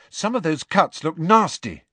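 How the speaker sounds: high, concerned voice; anxious. A narrator's reading. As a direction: point it out worriedly. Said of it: "said Hermione anxiously".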